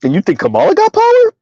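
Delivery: high-pitched, scoffing disbelief; incredulous. Real, not acted. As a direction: question it as if it were absurd. Scoffing rhetorical question to disagreeing commenter.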